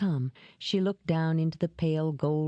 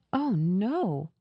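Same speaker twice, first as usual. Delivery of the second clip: higher-pitched, dismayed denial; mild horror in a raised voice; horrified; 3 semitones higher than usual. A narrator's reading. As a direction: recoil at the suggestion. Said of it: "There was horror in her voice".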